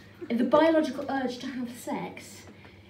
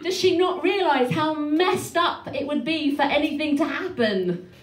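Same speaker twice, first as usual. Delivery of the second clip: loud, higher, emphatic comic indignation; mock outrage; 4 semitones higher, 6 dB louder than usual. Daughter appalled by mum's warning about the ex, mock outrage. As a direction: build exasperation to a loud final punch.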